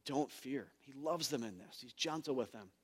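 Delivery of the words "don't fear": soft, loving words to frightened disciples; loving reassurance. Retold or imitated. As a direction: reassure them gently, much softer. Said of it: "lovingly says".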